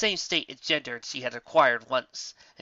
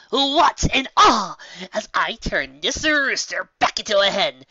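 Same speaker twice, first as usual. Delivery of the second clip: higher, louder and slow; showy incantation; 7 semitones higher, 8 dB louder, slower than usual. Acted. Trixie performing the spell for Lyra, grandly. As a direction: declaim it to an audience.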